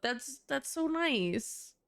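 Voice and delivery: slow high swoon over a sweet game moment; gushing. Real, not acted. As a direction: melt over it slowly. Gushing again.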